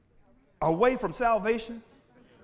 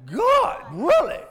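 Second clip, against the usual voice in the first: loud, high-pitched incredulous outburst; mock disbelief; 8 semitones higher, 10 dB louder, much slower than usual. Preacher playing someone hearing the unbelievable from God, loud mock disbelief. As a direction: act out an astonished listener, voice leaping up. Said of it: "so unbelievable, that you're gonna sit there and go".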